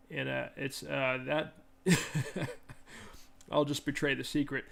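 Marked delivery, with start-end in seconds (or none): 1.8-3.2 short airy laugh; a quick unvoiced chuckle; breathy laugh. Real, not acted. Laughs at the team name.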